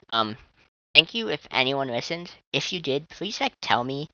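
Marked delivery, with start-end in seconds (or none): none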